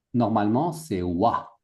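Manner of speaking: ordinary talk, nothing marked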